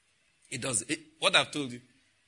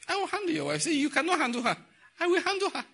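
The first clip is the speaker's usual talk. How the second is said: voicing God; 14 semitones higher than usual. God speaking in story.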